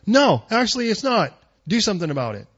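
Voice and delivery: high, loud declaration; a preacher voicing God high and loud. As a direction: boom it out as God answering. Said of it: "Do you need God to say".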